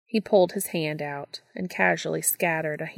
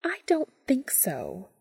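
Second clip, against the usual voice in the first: slow, higher, whispered smirk; 6 semitones higher, slower than usual. Acted. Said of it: "She smirked".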